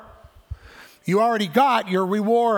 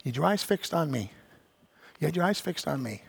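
gentle reproach; 4 semitones lower, 7 dB quieter than usual. Retold or imitated. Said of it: "I think the Lord's like".

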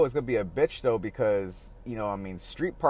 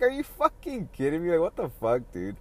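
exasperated disbelief; 5 semitones higher than usual. Retold or imitated. Complaining about the beach closures, high incredulous outburst. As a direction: throw it up high like you can't believe it.

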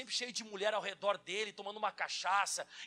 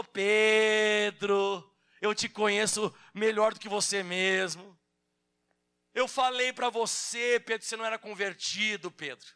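Jesus to Peter; 6 dB louder, slower than usual. Acted.

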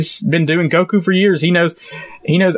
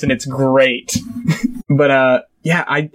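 ordinary talk, nothing marked

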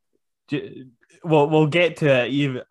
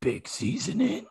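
mock manly voice; 4 semitones higher, 6 dB quieter than usual. Shown as a demonstration. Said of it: "This is how they talk the whole promo".

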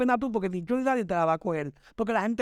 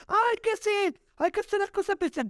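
high-pitched, exaggerated mimicry; mocking whine; 11 semitones higher than usual. Mimicking people who fight over visibility, mocking. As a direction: mimic them in a much higher put-on voice.

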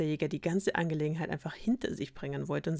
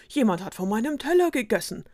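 indignant dwarf; 5 semitones higher, 6 dB louder than usual. Acted.

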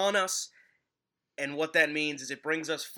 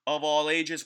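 booming ringmaster welcome; 7 semitones higher, 5 dB louder than usual. Acted. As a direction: announce it like a circus ringmaster. Podcast host's circus-style opener.

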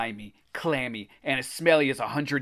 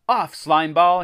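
loud, raised-pitch command; yell; 5 semitones higher, 9 dB louder, slower than usual. A narrator's reading. Greg pounding the blob with a stick, yelling. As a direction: yell at the creature. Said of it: "he yelled".

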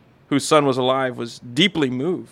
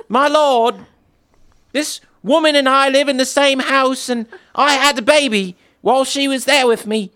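higher, louder British woman's plea; mock British accent; 9 semitones higher, 4 dB louder than usual. A woman pleading to the king in a hammed-up British accent. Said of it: "sounds far better with some little British accent".

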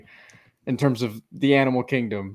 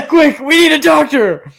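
hammed-up high, loud panic; mock panic; 6 semitones higher, 8 dB louder than usual. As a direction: shout for a doctor in mock alarm. Acting a panicked medical scene.

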